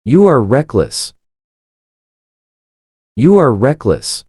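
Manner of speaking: ordinary talk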